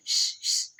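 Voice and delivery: whispered spreading श; spread shh. Shown as a demonstration. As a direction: let श spread out. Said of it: "आवाज़ फैल रही है". (फैलती हुई श की आवाज़)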